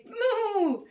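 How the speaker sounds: high, loud falling moo; big drawn-out cow call; cow's moo. A narrator's reading. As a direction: moo out in triumph. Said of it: "Then the cow said".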